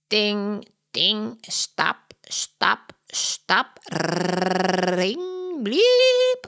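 squawky parrot voice, ending on a very high bliep; a parrot copying the hotel noises, shrieking the last one; parrot mimicking sounds. Polly the parrot calls out the hotel sounds to Holmes. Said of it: "roept Polly".

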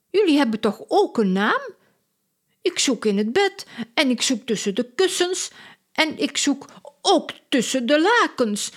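high-pitched, eager circus woman; excitable circus lady. A narrator's reading. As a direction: play Lola, breathless with worry. Lola searching.

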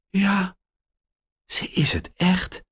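hoog, ongelovig gefluisterd; ongelovig fluisteren. Acted. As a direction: fluister het vol verbazing. Max gelooft zijn ogen niet. (Max fluistert verbijsterd bij het zien van zijn heldin)

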